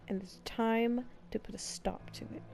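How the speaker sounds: ordinary talk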